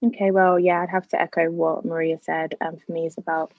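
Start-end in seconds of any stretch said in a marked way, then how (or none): none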